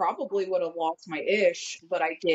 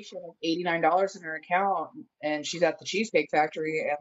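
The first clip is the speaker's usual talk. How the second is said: ordinary talk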